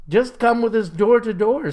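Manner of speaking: a hint of an eager girl scout. A narrator's reading. Girl scout character speaks.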